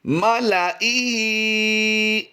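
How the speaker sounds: मालाइही chanted very slowly and high, lam kept short; long drawn melodic मालाइही; final model recitation of मालाइही; Quranic recitation. Shown as a demonstration. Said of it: "फिर last time पढ़ लीजिए".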